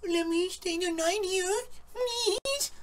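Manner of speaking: high, soft mock-teary pleading; whiny crying plea. Mocking the crying girl begging to stay the night, high whiny plea. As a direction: imitate a girl begging through fake tears. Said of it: "is crying, and she's like".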